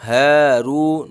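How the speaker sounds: ہے رو with both vowels held long; slow drawn-out ہے رو. Shown as a demonstration. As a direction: stretch each syllable of ہے رو slowly. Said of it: "ہا اور را دونوں کھینچیں گے".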